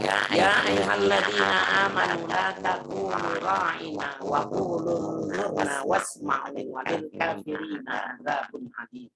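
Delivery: slow chanted Arabic verse; Quran recitation. Acted. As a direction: recite the verse slowly and melodically.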